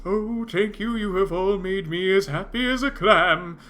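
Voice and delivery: high, chirpy grateful clam voice; a happy little shellfish thanking them; talking oyster. Acted. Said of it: "He says".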